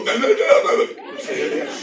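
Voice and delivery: voz cambiada. Retold or imitated. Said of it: "diría algo así como".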